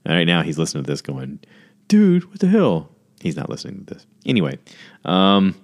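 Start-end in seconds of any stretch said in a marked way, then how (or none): 1.9-2.9 high, slow mock outrage; hammed-up indignant guy; indignant husband. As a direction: play the offended husband, whining. Imagined husband reacting indignantly.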